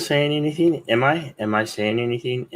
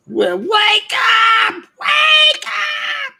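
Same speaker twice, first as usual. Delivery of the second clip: high, loud, stretched-out yell; slow shrill wake-up holler; wake-up shout; 4 dB louder, much slower than usual. Acted. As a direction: bellow it like a drill sergeant rousing the room.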